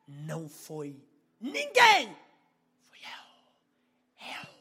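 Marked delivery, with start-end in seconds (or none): 1.4-2.1 sudden high shout; emphatic shout. Real, not acted. Preacher stresses nobody else prayed for mother, sudden shout. 2.9-4.5 whispered claim after a shout; hushed emphasis. Real, not acted. Preacher says he prayed for her himself, whispered.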